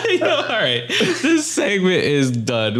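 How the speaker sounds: slow, laughing sign-off. Real, not acted. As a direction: wrap up while laughing. Laughing wrap-up.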